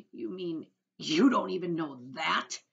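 loud, slow, mocking disbelief; incredulous cry. A narrator's reading. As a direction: jeer in amazement at his ignorance. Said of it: "cried the centipede".

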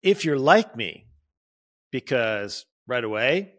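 ordinary talk

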